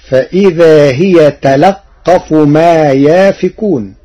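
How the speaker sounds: تلقف with an open lam and a doubled qaf, slow; Warsh reading. Shown as a demonstration. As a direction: say تلقف as talaqqaf. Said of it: "بفتح اللام وتشديد القاف". (تلقف بفتح اللام وتشديد القاف)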